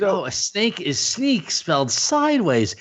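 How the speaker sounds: high, slow dawning wonder; mock amazed realization. Fan repeating a cartoon hero's odd line, mock amazed realization. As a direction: draw it out as if it suddenly makes sense. Acts out sudden realization of quote.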